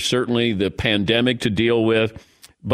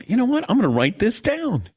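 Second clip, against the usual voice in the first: high-pitched mock eureka; re-enacted bright idea; 10 semitones higher than usual. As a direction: act out being pleased with your own idea. Host re-enacts his proud moment.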